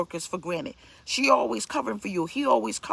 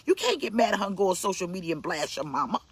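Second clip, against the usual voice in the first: usual speaking voice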